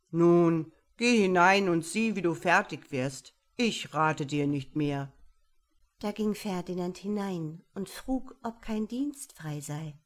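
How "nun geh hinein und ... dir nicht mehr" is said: lauter, knapper Bärenabschied; Bär zum Abschied. Acted. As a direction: ihn entschieden entlassen. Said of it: "und sprach". (bestimmter Bär beim Abschied)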